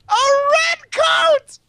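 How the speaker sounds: high, slow shouted mockery; mocking shout. Viewer shouting the guest's insult back, loud and high. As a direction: yell it out in delight.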